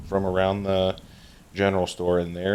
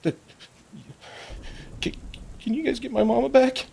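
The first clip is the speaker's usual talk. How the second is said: put-on very high, tearful little-girl voice; whimpering young girl; faster than usual. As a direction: whimper it in a high, small child's voice.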